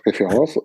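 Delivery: "reference" in a French accent, ray-fay-RAHNS; "reference" slipping back into French sounds. Real, not acted. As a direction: say "reference" with French Rs and a nasal ending. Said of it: "Sorry, my French accent".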